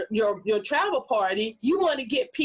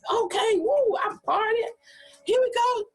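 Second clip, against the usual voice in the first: much higher, whooping party energy; partying hype; 12 semitones higher than usual. Retold or imitated. Guest already partying before the game, hyped whoops. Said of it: "'Cause you done been".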